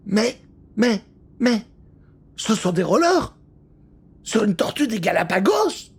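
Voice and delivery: sputter, unable to believe it; stunned exclamation. Acted. Léo amazed at a tortoise on rollers, stammering in disbelief. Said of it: "s'exclame Léo plus interloqué".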